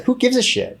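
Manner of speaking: high-pitched, fed up; exasperated. Real, not acted. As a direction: throw it away, fed up. Exasperated rant peak.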